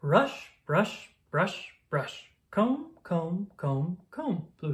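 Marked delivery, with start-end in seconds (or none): none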